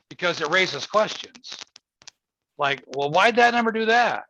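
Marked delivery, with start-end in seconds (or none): 3.0-4.2 high, louder puzzled wondering; puzzled question. Retold or imitated. Doctor voicing a curious client, high and puzzled. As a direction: sound like a client baffled by a test result.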